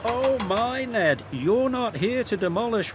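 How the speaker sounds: high-pitched, flustered correction; rattled protest. A narrator's reading. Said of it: "rattled Oliver".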